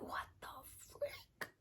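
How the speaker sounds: hushed, breathy what-the-frick; shocked whisper. Retold or imitated. Reacting to a cheesy book plot, hushed whisper. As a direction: whisper it in stunned disbelief.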